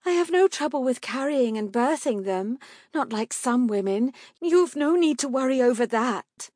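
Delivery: high, insistent wife's voice; pleading mother. A narrator's reading. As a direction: argue your case high and urgently. Mother keeps pleading with husband.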